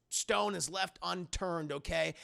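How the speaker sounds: normal talking voice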